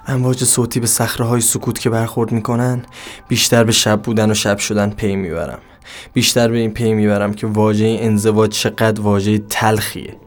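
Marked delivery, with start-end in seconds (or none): none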